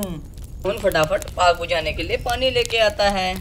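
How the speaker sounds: usual speaking voice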